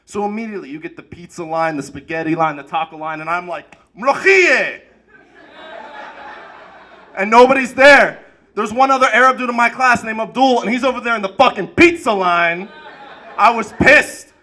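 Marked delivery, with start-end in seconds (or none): 4.0-4.8 calling out a food name proudly, a bit high and loud; excited call. Retold or imitated. Kid calling out favorite food. 13.4-14.3 mock anger. Angry at Abdul.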